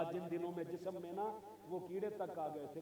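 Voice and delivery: very soft retold dialogue; hushed recollection. Ayyub recalls his days of sickness. (दबी, नीची आवाज़ में याद करते हुए)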